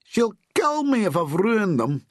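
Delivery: high-pitched dread; woeful high worry; moaning. A narrator's reading. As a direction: moan it high, fearing a telling-off. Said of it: "he moaned".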